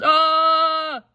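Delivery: high, loud pained yell; father cries out from a bite; sharp high ah; yelp. Acted. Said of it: "and he yelled".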